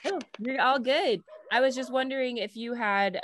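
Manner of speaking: ordinary talk, nothing marked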